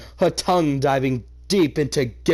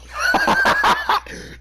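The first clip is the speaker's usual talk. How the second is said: a high, loud burst of real laughter; shrieking laugh; 4 dB louder than usual. Real, not acted. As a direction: crack up in a howl. Reader breaks up at the story.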